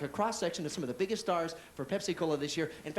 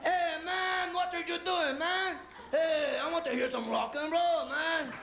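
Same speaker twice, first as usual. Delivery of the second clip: a hepcat car demanding rock and roll; rock-loving car voice; 11 semitones higher, much slower than usual. Acted. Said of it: "my car decides to tell me".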